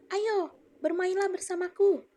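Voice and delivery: high, loud, slow invitation; a happy apple tree inviting the boy; lively quoted speech. Shown as a demonstration. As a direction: beckon a friend to come play. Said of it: "Ibu akan mencontohkan".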